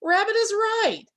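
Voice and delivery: lightly voiced groundhog mulling it over; groundhog thinking. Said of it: "And the groundhog says".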